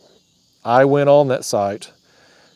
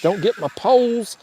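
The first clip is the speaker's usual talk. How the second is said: high pitched worried cry to the fish; begging the fish to stay clear; alarmed plea; 10 semitones higher than usual. Real, not acted. Fish tangling in his poles.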